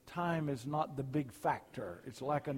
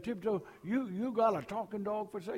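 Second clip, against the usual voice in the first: Cajun talk; 6 semitones higher than usual. Retold or imitated. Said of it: "I love to hear that Cajun talk".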